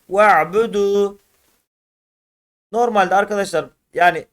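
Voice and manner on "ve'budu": "Ve'budu" with a short first e, straight into the ayn; don't stretch the ve in "Ve'budu"; short first syllable, long final u in "Ve'budu"; without lengthening. Shown as a demonstration. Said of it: "Çekmeden".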